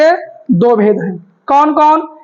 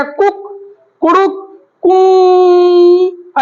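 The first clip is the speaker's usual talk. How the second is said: imitated cock-crow, short notes then a long higher कू; rooster crow; 4 semitones higher, much slower than usual. Teacher mimics a rooster showing three vowel lengths, rooster crow. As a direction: crow like a rooster, holding the last note. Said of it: "मुर्गा देखते हैं बोलता है".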